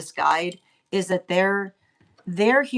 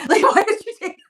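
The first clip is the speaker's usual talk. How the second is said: much higher, laughing through the words; giggling as the question breaks off; 9 dB louder, faster than usual. Real, not acted. Laughs mid mock rant.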